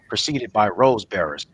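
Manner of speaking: usual speaking voice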